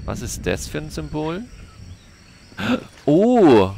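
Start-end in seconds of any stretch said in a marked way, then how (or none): 2.6-3.7 sharp gasp, then a high, loud "Oh!"; startled gasp. Real, not acted. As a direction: caught off guard by the storm. Sturm überrascht den Spieler. (erschrockenes Luftholen, hohes lautes Oh)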